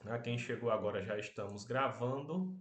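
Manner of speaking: normal talking voice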